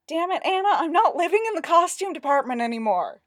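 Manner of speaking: loud, high-pitched whine; whining. A narrator's reading. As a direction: protest in an aggrieved whine. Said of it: "Jeffrey whined".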